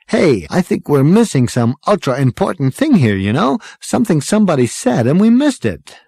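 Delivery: high-pitched, loud puzzling aloud; excitable braggart. A narrator's reading. Zaphod tells friends they missed something, excitable.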